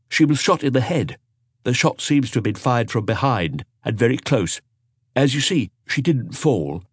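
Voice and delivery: a hint of an eager young inspector. Dupin reports to Maigret.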